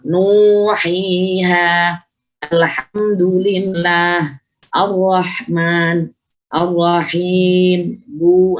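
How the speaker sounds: very slow, sustained chant of each word; measured tajwid demonstration, vowels held long; long vowel recitation. Shown as a demonstration. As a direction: stretch the long vowels in "nuhiha, alhamdulillah, ar-Rahman, ar-Rahim". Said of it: "Jadi pengucapan hurufnya".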